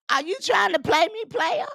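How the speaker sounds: high, fast, rising taunt; mocking challenge. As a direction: throw it at him, sing-song and rising. Mocking question repeated three times.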